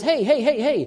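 hint of a toddler demanding attention; pestering toddler. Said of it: "that toddler who says".

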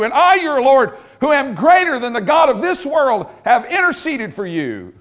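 high, loud, driving proclamation; full-force preaching. Real, not acted. Preacher assuring believers Almighty God has interceded, full-force preaching. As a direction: build to a ringing pulpit climax, each title punched.

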